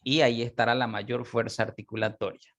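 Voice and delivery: usual speaking voice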